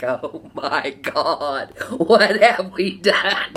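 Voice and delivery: slow, loud, laughing wail; half-laughing retold shock; giggling through a hammed-up gasp; laughing mock dismay. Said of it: "I was like".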